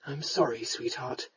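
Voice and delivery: low, hushed, comforting man; soft. A narrator's reading. Lord holds his sweetheart, consoling softly. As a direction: murmur low and tenderly. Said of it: "he said softly against her curls".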